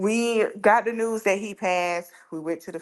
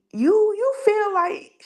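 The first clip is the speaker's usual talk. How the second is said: spooked; 13 semitones higher, 4 dB louder, slower than usual. Retold or imitated. Spooked question to sister.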